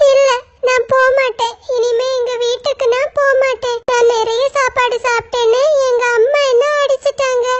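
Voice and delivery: a small crow who has been crying, refusing to go home; little crow, tearful and stubborn; upset little crow. Acted. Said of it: "ஒரு சின்ன காக்கா அழுதுகிட்டே".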